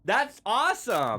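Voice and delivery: high, loud, delighted. Real, not acted. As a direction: savor it with glee. Delighted by the name.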